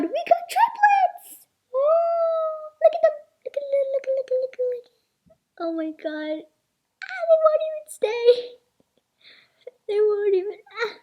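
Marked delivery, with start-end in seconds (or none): 0.0-5.4 very high, loud ecstatic shriek; excited shriek. Real, not acted. Discovering triplets, high loud ecstatic shriek. Finding three toys. 7.0-10.9 excited whine. Real, not acted. Toys won't stand up.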